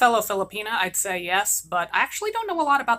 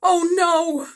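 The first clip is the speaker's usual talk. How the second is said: higher, louder cry of horror; horrified cry; 6 semitones higher, 6 dB louder than usual. Acted. Mother realising her curse came true, horrified cry. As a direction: cry out in dismay.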